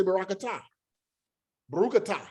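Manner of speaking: "barakatah" and "Barukatah" with a hard T; modern T pronunciation. Shown as a demonstration. Matching a brother who says atah, "barakatah" with T. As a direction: end both words on "tah", not "tha". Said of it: "if I know my brother say atah".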